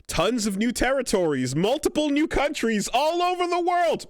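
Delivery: high, loud overblown proclamation; mock hype. Host mocking Evo announcements for new countries, high shouted hype. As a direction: blare it out like breathless event hype.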